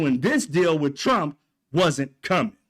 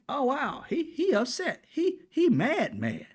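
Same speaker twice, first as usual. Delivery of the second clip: higher, teasing mock wonder; mock amazement; 8 semitones higher, 3 dB quieter, slower than usual. Vlogger teasing a host who got angry, mock wonder. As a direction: fake surprise, pitched up and drawn out. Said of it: "And I'm like".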